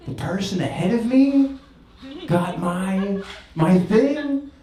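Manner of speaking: high-pitched, halting disbelief; blown away. Retold or imitated. Customer to window lady about a paid order, blown away. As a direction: act out being stunned, voice way up in pitch. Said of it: "I was, like, blown away".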